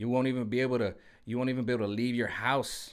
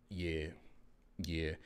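much lower, much softer yeahs; agreeing mutter; 9 semitones lower, 12 dB quieter than usual. Retold or imitated. People quietly catching on, low soft murmur. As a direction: murmur agreement under your breath. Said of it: "people be like".

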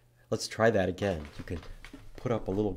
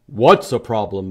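louder puzzled moose; loud curious moose; moose asking; 10 dB louder than usual. Acted. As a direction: ask it as the big moose. Moose asks in picture book.

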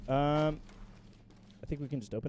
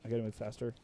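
hushed whisper; 6 semitones lower, 12 dB quieter than usual. Real, not acted. Sneaking, hushed to himself.

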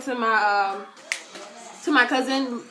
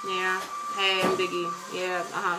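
bored Diggy; 5 semitones lower, 6 dB quieter than usual. Retold or imitated. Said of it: "He was just like".

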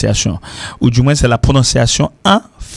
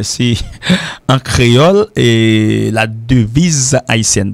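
usual speaking voice

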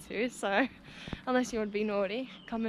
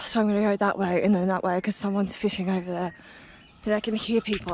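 ordinary delivery

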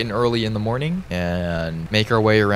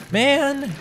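nervous high laughing. Real, not acted. Confused after serpent chase.

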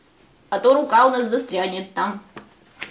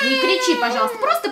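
fast pleading, higher; high-pitched plea; 11 semitones higher, much faster than usual. Real, not acted. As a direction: hurry a squeaky plea. Child shrieks, therapist pleads.

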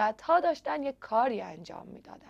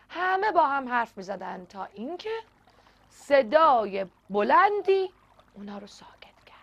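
plain reading voice

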